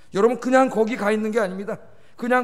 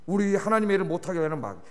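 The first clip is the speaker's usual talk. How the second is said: ordinary talk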